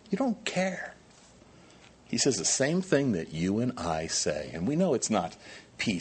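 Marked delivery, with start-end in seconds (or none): 0.1-0.9 pitched up high, whining "You don't care."; mock the complaint, higher and whinier; a higher, childish accusing echo of the first take; whiny accusation. Voicing disciples' complaint.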